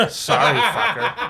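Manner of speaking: laughing through the words. Real, not acted. Laughing apology.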